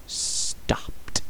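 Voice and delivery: near-whispered single word; soft, breath-only hush. A narrator's reading. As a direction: drop to a whisper for suspense. Narrator marks sudden silence.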